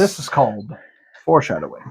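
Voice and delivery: low, soft stab at Scottish; attempted Scottish accent. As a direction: deliver it deadpan in bad Scottish. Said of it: "Can't do Scottish".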